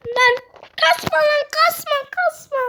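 high, loud urgent yell; pleading at the screen not to mess up. Real, not acted. Gamer yelling at his character mid-jump, urgent high yell.

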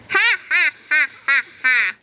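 high, mocking ha-ha-ha; principal's sneering laugh. A narrator's reading. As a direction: laugh them down scornfully. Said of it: "laughed Mister Krupp".

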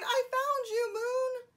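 high, loud triumphant cry; cried out. A narrator's reading. Bear shaking tree, crying out. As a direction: call out excitedly to the moon. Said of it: "shook the tree and cried".

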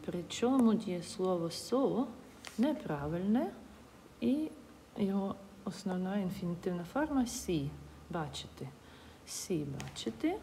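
ordinary talk

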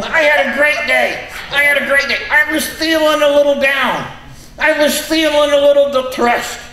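high, squeaky puppet voice; squeaky ventriloquist dummy. Acted.

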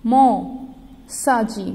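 "मौसा जी" drawn out very slowly; slow sounded-out word. Shown as a demonstration. Teacher reading "मौसा जी" to children, slowly stretched. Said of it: "Gauri की".

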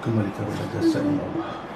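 very soft murmured bismillah; hushed prayer. Real, not acted. Healer murmuring softly over the patient. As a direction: breathe the words almost silently.

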